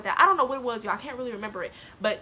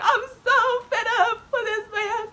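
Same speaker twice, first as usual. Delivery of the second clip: loud, high, drawn-out wail; hammed-up tearful whine; mock crying; 12 semitones higher, 8 dB louder, slower than usual. Student cries to teacher, fed-up mock wailing. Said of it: "I'm sitting there crying".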